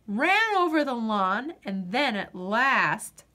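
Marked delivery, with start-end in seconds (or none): none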